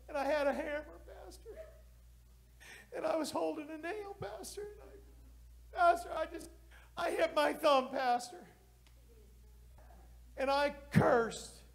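high, wailing mock sob, rising with each Pastor; imitating sobbing. Alan confessing to his pastor, high mock sobbing. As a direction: whimper it out like a grown man crying.

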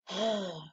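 soft sigh letting the negativity pass; shrugging-off sigh. Retold or imitated. In meetings, she sighs off negative talk. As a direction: sigh it away quietly. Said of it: "'cause I didn't wanna receive it".

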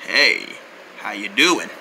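very low, soft "cool guy" voice; smooth greeting. Acted. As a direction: greet the cat low and suave. Clears throat, greets the cat smoothly.

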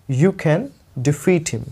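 very slow, spaced reading; slow model sentence. Shown as a demonstration. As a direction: read it slowly for learners. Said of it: "teacher models the sentence slowly".

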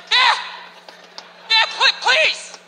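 high, slow, desperate begging; pleading gorilla. Acted. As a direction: beg to be freed, each phrase stretched. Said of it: "And the thing would be like".

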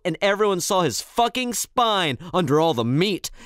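ordinary delivery

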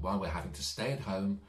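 plain reading voice

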